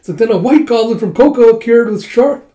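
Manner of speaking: puppet show narrator. Acted. Puppeteer telling the townsfolk the battle, dramatic announcing. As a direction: announce it to a street crowd.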